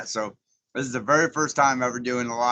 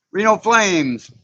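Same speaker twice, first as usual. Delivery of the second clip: higher, louder and drawn out; excited shout-out; 9 semitones higher, 9 dB louder, much slower than usual. Real, not acted. Greeting a viewer in chat, loud slow excitement. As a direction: call the name out slowly with delight.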